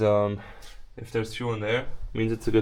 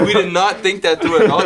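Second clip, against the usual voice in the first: laughing; 7 semitones higher, 9 dB louder than usual. Real, not acted. Laughing after nasty fuel test.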